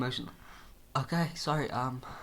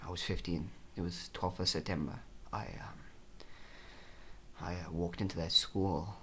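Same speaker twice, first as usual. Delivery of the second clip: softer, low dramatic telling; mock grim confession; 4 semitones lower, 7 dB quieter than usual. Patient confessing to therapist, soft grim tone. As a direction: tell it like a hushed dark secret. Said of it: "dark confession".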